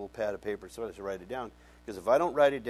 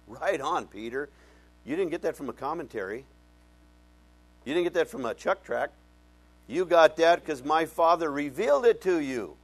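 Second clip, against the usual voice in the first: rising enthusiastic Jesus; 4 semitones higher, 8 dB louder than usual. Retold or imitated. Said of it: "He goes".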